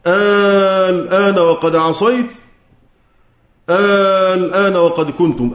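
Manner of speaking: "الآن" drawn out in slow Quranic recitation. Shown as a demonstration. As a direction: recite both verses slowly with the long a held. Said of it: "في سورة يونس". (تلاوة بطيئة لـ"الآن وقد عصيت" و"الآن وقد كنتم")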